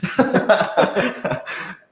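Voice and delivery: airy unvoiced giggle; breathy laughter. Real, not acted. Cast member laughing at his own tease, airy giggle. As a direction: laugh through the breath at your own joke. Laughs after teasing answer.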